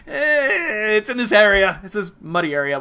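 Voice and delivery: exaggerated comic shrug in the voice; noncommittal hedging. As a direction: play up the waffling, high and loud, easing down. Mimics vague hedging about the term.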